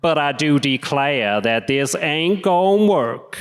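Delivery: slow mock Southern drawl; slow Southern gentleman accent. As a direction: keep the Southern gentleman voice for the joke. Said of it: "Southern gentleman".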